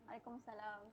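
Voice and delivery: faint distant caller on the line; speaking far from the microphone; far from mic. Real, not acted. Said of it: "kuat sikit. kami tak berapa dengar".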